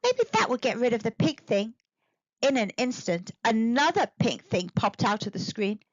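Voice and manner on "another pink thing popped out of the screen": ordinary delivery, nothing marked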